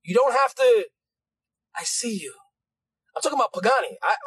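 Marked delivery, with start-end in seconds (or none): 1.7-2.3 soft, low murmur of comfort; hushed reassurance. Acted. Apostle affirms someone who need not yell, hushed reassurance. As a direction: say it softly and low.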